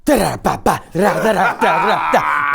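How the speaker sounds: rapid-fire nonsense syllables; imitated rattling noise.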